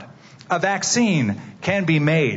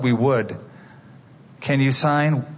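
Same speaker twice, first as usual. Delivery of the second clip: normal talking voice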